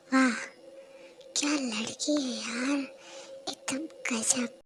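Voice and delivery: low leering murmur, slowed down; lecherous breathy aside. Acted. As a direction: savour every word like a creep. Lecher ogling a girl.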